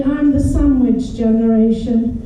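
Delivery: normal talking voice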